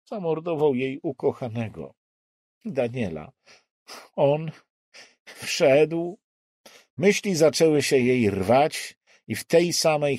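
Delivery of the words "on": ordinary delivery, nothing marked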